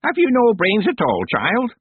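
high, loud scolding crone; scolding old witch. Acted. Miss Treason scolding.

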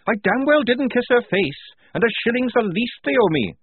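high, loud indignant denial; angry retort. Acted. Tonka denying she kissed the picture, angry. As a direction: fling the accusation back.

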